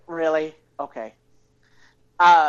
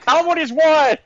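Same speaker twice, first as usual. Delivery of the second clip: game show host; 9 semitones higher, 7 dB louder than usual. Pretend.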